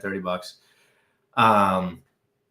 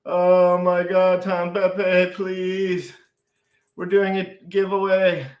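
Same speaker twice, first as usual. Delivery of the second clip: high, drawn-out manic plea; plead, overwhelmed by excitement; 8 semitones higher, 3 dB louder, slower than usual. Real, not acted. Begging Tom Pepe during the giveaway, high manic plea. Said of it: "I'm having a manic episode".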